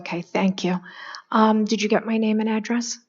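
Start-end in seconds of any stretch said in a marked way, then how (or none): 0.0-0.8 breathy unvoiced whisper; words with no voice. Real, not acted. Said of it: "I have a vocal condition".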